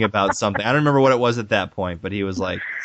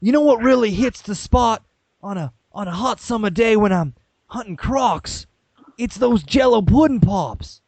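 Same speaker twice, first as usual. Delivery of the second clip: put-on Australian accent; 7 semitones higher than usual. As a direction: sell pudding pops like a croc hunter. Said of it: "my Australian accent".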